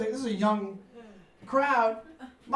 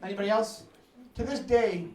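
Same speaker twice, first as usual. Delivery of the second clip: ordinary talk, nothing marked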